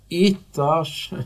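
bitterly mocking question; bitter scoff. A narrator's reading. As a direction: throw it back with bitter scorn. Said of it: "keserű nevetéssel".

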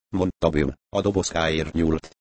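plain reading voice

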